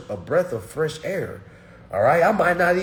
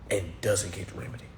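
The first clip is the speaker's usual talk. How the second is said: whisper; 7 semitones lower, 9 dB quieter, faster than usual. Real, not acted. Trails into a whisper.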